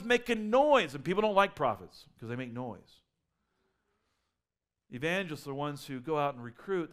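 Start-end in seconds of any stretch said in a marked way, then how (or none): none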